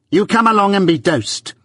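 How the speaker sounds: high, loud harsh command; harsh order. A narrator's reading. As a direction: bark the order. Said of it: "the awful words".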